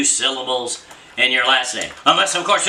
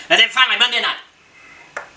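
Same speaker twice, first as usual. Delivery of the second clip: announcer build-up; 10 semitones higher than usual. Acted. Announcing the big game.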